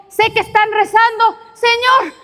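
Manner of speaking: put-on high, urgent old woman's plea; pleading grandmother. Grandmother bursting into church, begging the Lord, high and urgent. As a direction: beg the Lord with everything she has.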